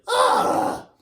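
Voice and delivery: loud unvoiced groan of exasperation; exasperated groan. Tempo groaning at Wild Bill's question, loud and unvoiced. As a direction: let out a fed-up huff. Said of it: "Tempo just went".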